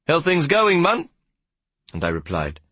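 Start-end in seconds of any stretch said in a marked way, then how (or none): 0.1-1.1 high-pitched friendly hail; calling out. A narrator's reading. Warder calls out to a passing boy, hailing him. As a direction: call it out across a distance, pitched up. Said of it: "He called out to me".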